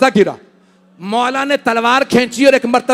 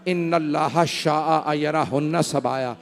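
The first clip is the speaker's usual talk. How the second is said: hushed, much softer grieving narration; soft mournful recitation; 5 semitones lower, 6 dB quieter than usual. Acted. Preacher reciting the farewell at the Prophet's grave, hushed and mournful. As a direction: draw the mourners in close with quiet grief.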